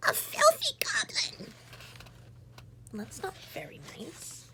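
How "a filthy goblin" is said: hissed, breathy disgust; mock disgusted farmer. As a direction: spit it out in disgust. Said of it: "shrieked the farmer".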